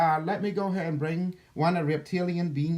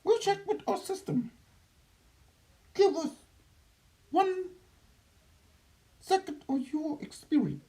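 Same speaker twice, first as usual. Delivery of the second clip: high alien reptilian voice; otherworldly being, high and strange; Doctor Nucky; 11 semitones higher than usual. Acted. Channeled being answers questions.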